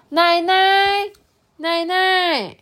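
high, drawn-out call; loud calling out. Acted. Anan calls for Grandma at the house, loud calling out. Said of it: "安安大声叫说".

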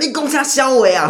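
high, loud street yell; angry yell. Retold or imitated. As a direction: snap at him like a thug. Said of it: "就说". (在骑楼吵架时凶狠地吼)

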